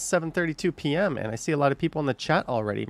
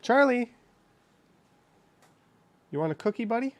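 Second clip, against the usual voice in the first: talking to a dog; 10 semitones higher, faster than usual. Real, not acted. Speaker turns to her dog.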